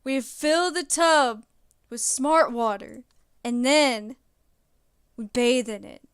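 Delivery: loud, drawn-out dumb frat bro; slow hyped dude-bro pitch; frat boy voice. Pretend. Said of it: "You gotta do it in the frat boy voice".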